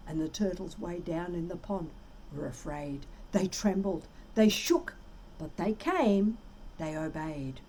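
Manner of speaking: usual speaking voice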